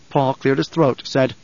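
ordinary delivery, nothing marked

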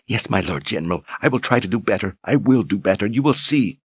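soft, high, eager submission; meek old woman. Acted. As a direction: promise it meekly. Lunetta submitting meekly.

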